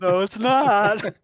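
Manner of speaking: slow, laughing no it's not; amused slow denial; laughing retort. Retold or imitated. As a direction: drag it out through a laugh. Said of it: "and I'm going".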